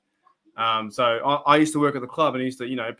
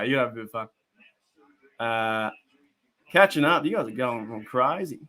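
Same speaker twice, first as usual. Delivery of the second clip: ordinary delivery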